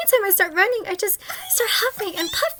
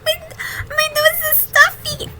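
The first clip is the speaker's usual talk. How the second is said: high whiny stuffy voice; whiny sick kid; 5 semitones higher, slower than usual. Acted. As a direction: whine like a sick little girl. Sick child asking for tissues.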